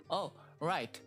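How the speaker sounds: ordinary delivery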